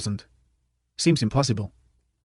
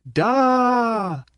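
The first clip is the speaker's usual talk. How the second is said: high cheer from the mob; crowd cheer; 11 semitones higher than usual. A narrator's reading. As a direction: yell it as one of the crowd.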